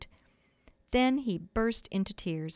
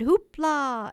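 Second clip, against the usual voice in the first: loud, higher whoop of Hoopla; cheerful cry; 6 semitones higher, 7 dB louder than usual. A narrator's reading. Boys cry out as they dance after him. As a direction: whoop it out in joy. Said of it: "they all cried".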